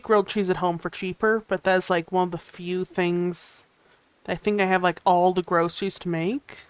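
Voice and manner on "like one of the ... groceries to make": ordinary delivery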